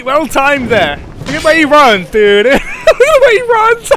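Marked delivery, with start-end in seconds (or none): 1.3-2.5 amused outcry. Real, not acted. Enemy running odd way. 2.5-4.0 high, slow laughing repeat. Real, not acted.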